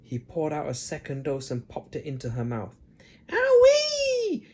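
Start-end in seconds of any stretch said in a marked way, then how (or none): 3.3-4.4 very high, loud shriek of glee; screechy crone shriek. Acted. Grandma shrieking as the dose hits, screechy. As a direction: shriek like a wild hag. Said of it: "she screamed up".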